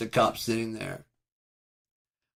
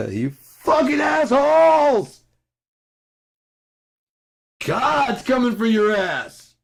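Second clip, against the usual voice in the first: high, drawn-out furious screaming; screamed rant; 10 dB louder, slower than usual. Real, not acted. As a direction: scream accusations at full force.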